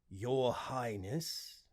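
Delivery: slow, lightly robotic servant voice; drawn out like a polite machine; an avatar answering its prince, slow and formal; male AI voice. Acted.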